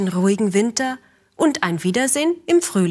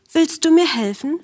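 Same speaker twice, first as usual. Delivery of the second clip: higher pleading bird voice; pleading woodpecker; 7 semitones higher, 3 dB louder than usual. A narrator's reading. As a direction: beg a friend for help. Woodpecker asks for help.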